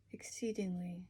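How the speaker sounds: breathy whispered word. Shown as a demonstration. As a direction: whisper it softly.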